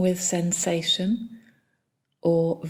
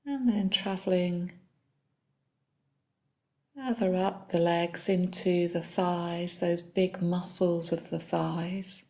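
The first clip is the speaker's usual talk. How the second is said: soft, unhurried body-scan guidance with long pauses; calm meditation leader, phrase by phrase; guided meditation; 6 dB quieter than usual. Real, not acted. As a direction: guide them gently through the body, leaving long silences.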